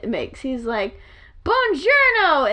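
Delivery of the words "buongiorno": slow, higher mock Italian greeting; Graham's greeting. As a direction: stretch it like a cheesy game character. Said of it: "the joke Graham in Animal Crossing".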